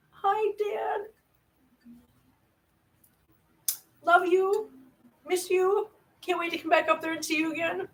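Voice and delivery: high, soft loving greeting; tender hello. Real, not acted. Greeting her dad on stream, tender and soft. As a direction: speak gently to a missed dad.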